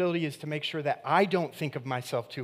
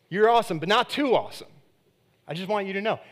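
backhanded praise; 7 semitones higher, 8 dB louder than usual. Retold or imitated. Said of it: "it's like".

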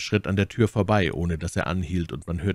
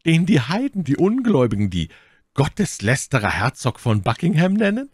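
high and loud denunciation; denouncing Milady; 9 semitones higher, 7 dB louder than usual. Acted. Milady names Buckingham to Felton, fervently denouncing him. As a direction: spit out the blasphemer's title with fervour.

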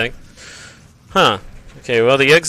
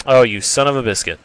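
normal talking voice

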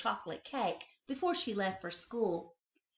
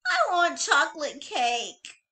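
put-on demanding child; 7 semitones higher, 8 dB louder than usual. A narrator's reading. Child bunny demanding cake.

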